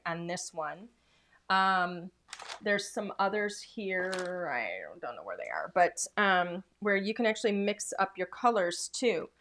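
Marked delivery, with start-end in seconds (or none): 4.5-5.7 muttered under the breath to herself; whispered aside. Real, not acted. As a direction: whisper it as a quick aside while looking. Muttered aside while searching.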